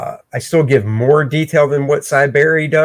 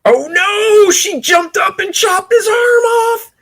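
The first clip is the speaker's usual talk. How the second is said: very high hammed-up squeal of shock; mock shock. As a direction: shriek it like a horrified fan.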